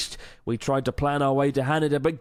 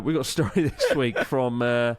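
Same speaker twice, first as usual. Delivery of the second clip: usual speaking voice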